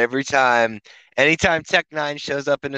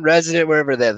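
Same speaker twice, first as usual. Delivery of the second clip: ordinary delivery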